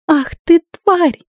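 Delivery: enraged scream of insult; roaring with rage. Acted. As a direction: spit it out while soaked and enraged. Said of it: "взревела она".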